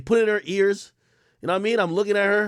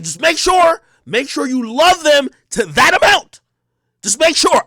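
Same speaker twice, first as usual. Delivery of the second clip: higher, drawn-out sing-song; fake generosity; 6 semitones higher, slower than usual. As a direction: pretend you're happy to pay. Fake-generous offer to girlfriend.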